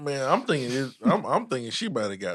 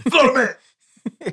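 higher, louder hyped announcer call; announcer shout; 12 semitones higher, 6 dB louder than usual. Acted. Cohost asked to do the intro, loud hyped announcer call. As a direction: belt out the segment name like a game show host. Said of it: "You gotta do it one more time".

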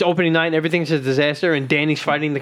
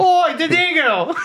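high, slow mock Australian cry; hammed-up Australian accent; 11 semitones higher, slower than usual. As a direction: drawl out a silly Australian accent, amused. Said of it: "what about Space Australia".

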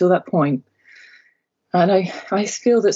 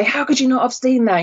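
higher-pitched, mildly appalled quote; mock aghast; 6 semitones higher than usual. Quoting a friend shocked at an unseen film, mildly aghast. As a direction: imitate a friend's aghast disbelief. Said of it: "with absolute aghast and disgust".

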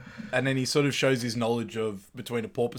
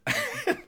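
high burst of laughter. Real, not acted. Laughing at the scene.

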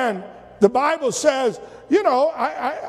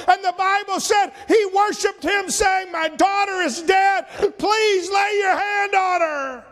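high-pitched, pleading pulpit cry; full-force preaching; 6 semitones higher than usual. Acted. Ruler begging God for his sick daughter, full-force preaching.

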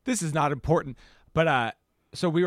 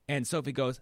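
ordinary talk

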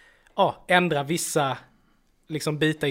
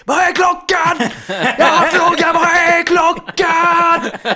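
full-force high bellow, words stretched out; hammed-up, drawn-out yelling; imitated roar; 14 semitones higher, 10 dB louder, much slower than usual. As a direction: roar it slowly as the raving man on the bus. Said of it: "och bara vrålar på mig".

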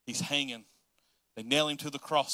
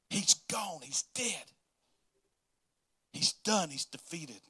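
a hushed whisper; slower than usual. Acted. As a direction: drop to a whisper on the news. Satan's party gloating over Jesus.

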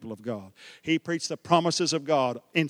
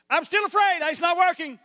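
high, whiny complaint; fretful whine; 11 semitones higher than usual. Retold or imitated. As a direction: plead like a scared follower. Disciple voiced fearful.

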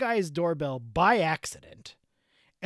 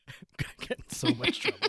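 laughing; 6 semitones higher, 5 dB quieter, much faster than usual. Real, not acted. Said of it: "wake all of my children up".

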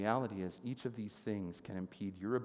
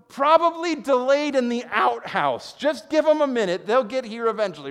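higher and louder teasing jeer; mocking taunt; 14 semitones higher, 17 dB louder than usual. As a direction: poke fun at the false prophets. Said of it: "really mocking these guys".

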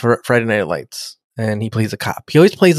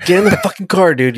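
mock order; 6 semitones higher than usual. Acted order, mock Picard.

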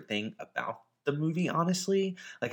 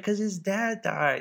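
put-on higher, slow little girl explaining; lightly voiced kid; imitating a little girl; 10 semitones higher than usual. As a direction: play the child stating the obvious. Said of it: "She's like".

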